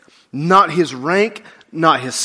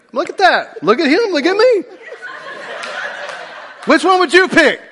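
excited comparison; 8 semitones higher than usual. Real, not acted. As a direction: egg the crowd on, delighted. Comparing himself to Zach.

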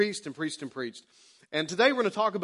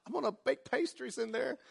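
high, soft mock gushing wife; hammed-up delighted homeowner in falsetto; 9 semitones higher, 5 dB quieter than usual. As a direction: squeal sweetly over the kitchen. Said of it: "all the people are like".

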